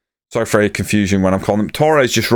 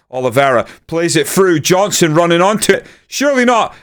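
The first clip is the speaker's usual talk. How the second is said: building excitement; 8 semitones higher than usual. Real, not acted. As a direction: voice rising as the chance opens up. Goal chance building in commentary.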